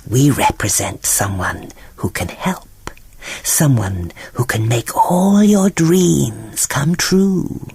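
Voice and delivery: slow, low sly whisper; whispering eel. Acted. Eels lure Ariel toward the witch, whispering slowly. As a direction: coax her in a hushed eel voice.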